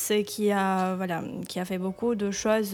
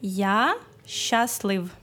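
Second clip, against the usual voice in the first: slow, careful teacher delivery; slow teaching demo; much slower than usual. Shown as a demonstration. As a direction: say it slowly so they can copy. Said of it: "Je suis heureux?".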